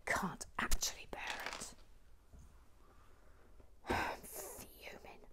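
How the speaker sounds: hushed grumbling to herself; whispered fuming; frustrated whisper. Real, not acted. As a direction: whisper through clenched frustration.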